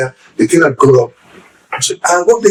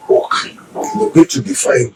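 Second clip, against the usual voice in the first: slow gentle mother's comfort, pitched up; mother's reassurance; slower than usual. Retold or imitated. Dying mother's advice, retold.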